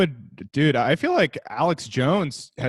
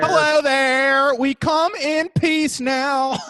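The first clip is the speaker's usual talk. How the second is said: high, slow alien greeting; drawn-out alien visitor; 10 semitones higher, much slower than usual. Pretend. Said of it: "the aliens were like".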